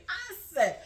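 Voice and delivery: ordinary talk, nothing marked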